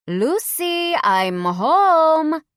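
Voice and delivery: put-on loud, high Cuban-accented call. Son calling to his mom in a Cuban accent. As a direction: announce it in a hammed-up Cuban accent. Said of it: "I announced in my best Cuban accent".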